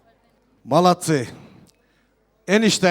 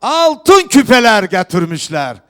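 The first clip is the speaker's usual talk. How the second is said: loud festive proclamation to the hall; turkish wedding announcer; 2 semitones higher, 7 dB louder than usual. Real, not acted. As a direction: call out each gift to the guests. Host proclaims gifts at wedding.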